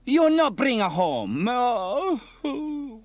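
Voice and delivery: high, loud, slow tearful wail; Bella wailing. Acted. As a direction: lament dramatically, breaking into a sob. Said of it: "wailed Bella".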